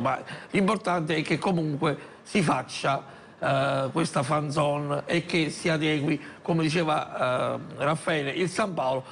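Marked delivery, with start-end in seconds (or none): none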